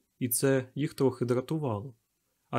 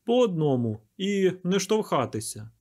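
higher, commanding call to a crowd; firm, raised-pitch instructions; peremptory order; 6 semitones higher, 4 dB louder than usual. A narrator's reading. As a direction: direct the queue, no argument allowed. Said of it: "безапеляційно звелів він".

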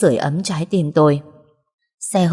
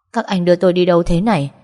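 plain reading voice

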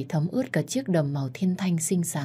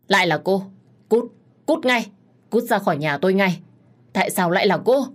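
louder, furious outburst ordering someone out; angry yell; 3 semitones higher, 5 dB louder than usual. A narrator's reading. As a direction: yell in anger, driving her out. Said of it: "hét lên giận dữ". (hét lên giận dữ)

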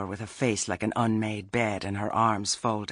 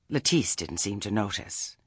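ordinary delivery

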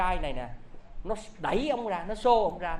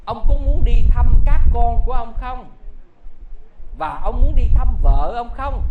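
raised, ringing voice of the spirit; loud calling; 6 semitones higher, 13 dB louder than usual. Acted. Son-in-law spirit calls the old rich man, loud and high.

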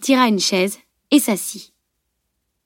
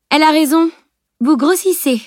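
high, raised-voice jibe; impudent, higher-pitched agreement; cheeky interjection; 7 semitones higher, 4 dB louder than usual. A narrator's reading. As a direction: butt in brazenly from behind. Camille's startling impudent interjection.

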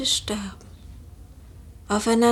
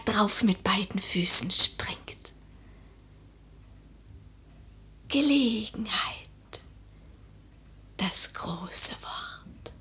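trailing whisper; 5 semitones lower, 7 dB quieter than usual. Acted. Lady musing alone at night, whispering. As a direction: savour the last words almost silently.

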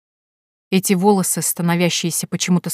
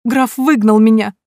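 higher, louder, slow and crying; tearful; 4 semitones higher, 6 dB louder, slower than usual. A narrator's reading. Elizaveta tells Nikolya the count threw her out, crying. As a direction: wail it through tears. Crying girl after expulsion.

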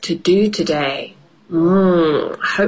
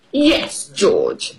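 bossy; 3 semitones higher than usual. Acted. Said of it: "Peppa says in a bossy voice".

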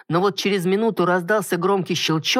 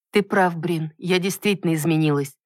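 ordinary delivery, nothing marked

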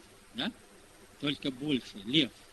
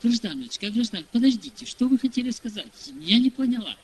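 mildly agitated secretary, voice raised higher and louder; urgent calling back; 6 semitones higher, 7 dB louder than usual. Retold or imitated. Secretary to a departing visitor, urgent, raised voice. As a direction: call after someone leaving, wanting an explanation.

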